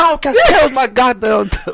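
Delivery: high, loud imitation of a cranky grandma woken at night; laughing mock scolding as grandma. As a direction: play the grumpy grandmother laying into the boy, half laughing.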